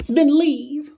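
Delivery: a cartoon-high brush-off; tossed-off falsetto dismissal; squeaky mock voice. Second high repeat.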